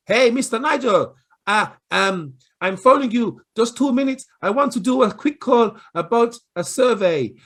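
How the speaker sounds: pushy cold caller. Retold or imitated. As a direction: barge in like a cold caller who won't stop. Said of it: "we've all get these phone calls".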